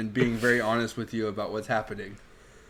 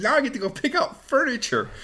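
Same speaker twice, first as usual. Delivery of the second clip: high mock cheer; 12 semitones higher than usual. Cal voiced in retelling.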